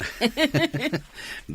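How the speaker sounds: high giggling laugh; bashful high laugh; embarrassed laugh. Acted. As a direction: giggle shyly at the teasing. Teased about a boyfriend.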